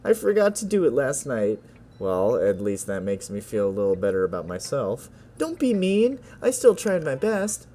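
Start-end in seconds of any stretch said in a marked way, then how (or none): 0.0-1.6 lightly voiced girl, higher pitch; game girl. Girl admitting she forgot, lightly voiced role. 5.4-7.7 put-on high-pitched hurt girl voice; protesting girl. Girl defending her effort to a friend, high pouty protest.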